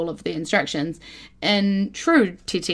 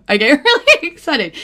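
giggly, higher and louder; bubbling over with glee; excited, laughing; 6 semitones higher, 6 dB louder than usual. Real, not acted. Admitting she gets excited, giggly and bubbly.

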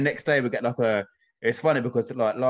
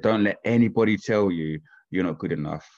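ordinary talk

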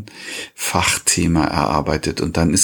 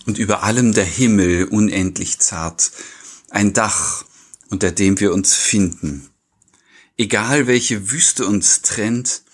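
Gedichtvortrag; 3 semitones higher than usual. Acted. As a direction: recite line by line, leaving room between phrases. Recites a poem. (ruhig vorgetragenes Gedicht mit Pausen)

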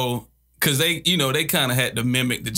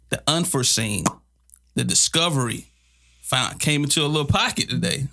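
usual speaking voice

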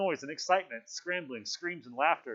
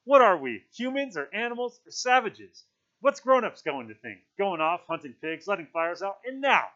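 loud, raised-pitch scolding; indignant outburst; 5 semitones higher, 10 dB louder than usual. A narrator's reading. Piggy berates the assembly, loud and indignant. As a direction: berate them at full volume.

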